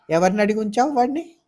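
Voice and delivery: sharp, fast angry question; angry demand. Acted. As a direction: demand it in anger. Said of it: "కోపం వచ్చింది ముక్తకి". (కోపంగా ప్రశ్నించడం)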